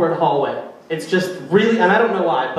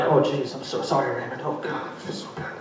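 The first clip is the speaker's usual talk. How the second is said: softer, lower, half-whispered sorry; sheepish fading apology; hushed mock apology; 5 semitones lower, 9 dB quieter than usual. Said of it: "they're like".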